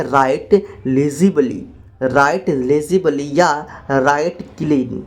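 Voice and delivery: very slow, word by word; slow dictation. Shown as a demonstration. Teacher dictating the English phrase, very slow. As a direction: stretch each word for learners. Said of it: "English में कैसे बोलेंगे".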